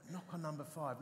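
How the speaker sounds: soft, low voiced murmur; little whisper. Inner voice nudges the reluctant vicar, little whisper. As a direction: imitate a small, quiet inner nudge. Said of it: "this little whisper".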